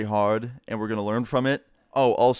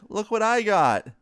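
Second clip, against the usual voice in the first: put-on higher, louder little-kid voice, pleased with himself; a six-year-old showing off his new toy; proud little kid; 10 semitones higher, 4 dB louder than usual. Little brother showing off the gun to the babysitters, proud and high-pitched.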